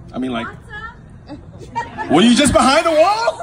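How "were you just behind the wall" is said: loud, high incredulous question; calling out. Real, not acted. Comic to someone behind the wall, calling out.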